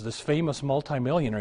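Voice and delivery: ordinary delivery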